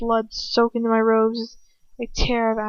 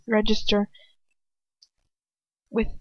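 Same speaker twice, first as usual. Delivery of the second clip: usual speaking voice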